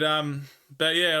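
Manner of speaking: normal talking voice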